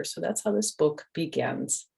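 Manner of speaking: ordinary delivery, nothing marked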